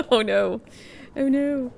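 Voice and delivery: ordinary talk, nothing marked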